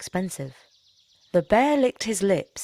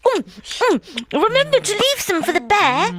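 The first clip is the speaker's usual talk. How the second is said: much higher, louder wife through a full mouth; mouth crammed full; 14 semitones higher, 6 dB louder than usual. Acted. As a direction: talk round a mouthful of dal. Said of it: "speaking with her mouth crammed full".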